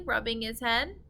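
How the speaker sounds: ordinary delivery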